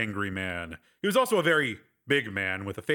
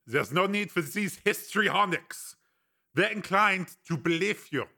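put-on higher-pitched accented voice, measured; Breck, calmly assured; 7 semitones higher, slower than usual. A narrator's reading. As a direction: reassure the prisoner in a foreign accent.

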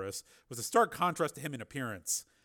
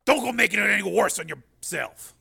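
higher, loud, irritated warning; snapping; 8 semitones higher, 12 dB louder than usual. A narrator's reading. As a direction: warn her off sharply. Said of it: "snapped the marshal".

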